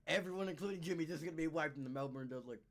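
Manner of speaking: ordinary talk, nothing marked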